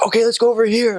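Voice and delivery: high, loud, eager call; eager member. Retold or imitated. Church member to the missionaries, high eager call. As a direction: charge ahead cheerfully.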